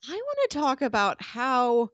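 ordinary delivery

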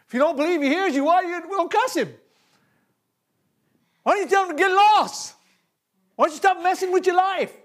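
climbing preacher's shout; rising preaching challenge. Real, not acted. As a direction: press the crowd harder with each question.

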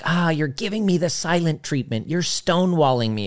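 high, whiny accusing partner; hurt accusation. Retold or imitated. Partner accusing the other of the silent treatment, high and whiny. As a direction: complain like a hurt partner who feels shut out. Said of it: "you're like".

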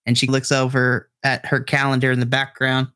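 ordinary delivery

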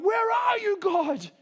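high, shouted cry of abandonment; desperate lament. Retold or imitated. David to God, shouted desperate lament. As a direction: call out to a God who seems gone. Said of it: "naked in it all and being like".

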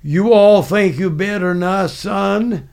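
imitated Southern drill sergeant; Southern accent. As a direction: challenge him in a Southern accent. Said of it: "He spoke with a Southern accent".

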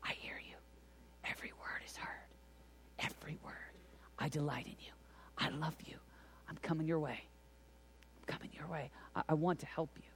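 very soft, whispered reassurance with gaps; tender whisper. Acted. God answering a weak prayer, tender whisper. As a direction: whisper it gently, phrase by phrase.

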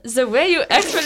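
shaky-grasp British accent, breaking into laughter; British accent demo, badly done. Shown as a demonstration. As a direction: attempt a British accent and crack up. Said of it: "slipping into the British accent".

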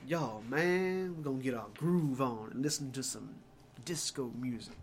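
laid-back caricature of a disco guy. Retold or imitated. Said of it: "he was a disco guy".

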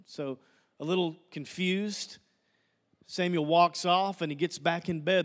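normal talking voice

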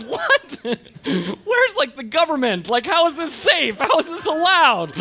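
high, loud comic indignation; alarmed dad losing it; outraged disbelief. Retold or imitated. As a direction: protest in a high, incredulous voice. Dad's outrage at unsafe slide.

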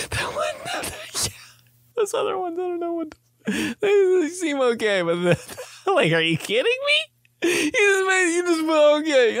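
smelling the everything bagel, squealing with joy; giddy squeal. Retold or imitated. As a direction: squeak out in overjoyed disbelief, near tears.

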